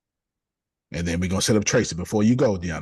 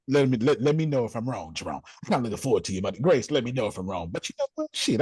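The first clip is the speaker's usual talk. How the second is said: ordinary delivery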